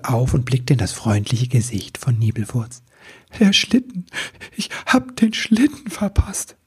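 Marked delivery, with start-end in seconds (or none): 3.4-6.6 high-pitched, tearful wail; sobbing. A narrator's reading. Christmas elf tells his friend he missed the sleigh, sobbing. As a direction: wail it through sobs, high and upset. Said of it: "Er schluchzte".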